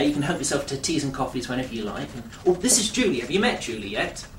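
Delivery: normal talking voice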